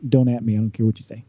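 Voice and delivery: ordinary delivery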